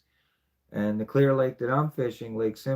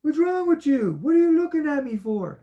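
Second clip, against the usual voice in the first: exasperated quote to the fish; 7 dB louder than usual. Retold or imitated. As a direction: squeal at the staring fish in a high put-on voice. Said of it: "And I'm like".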